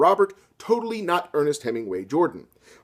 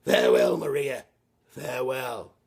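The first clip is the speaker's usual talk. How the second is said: dramatic farewell; 2 semitones lower than usual. Acted. Robert leaving on saucer.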